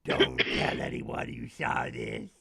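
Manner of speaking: slow, low, hissing monster whisper; gargoyle whisper. Acted. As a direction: creep it out like a secret-keeping gargoyle. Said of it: "And the gargoyle goes".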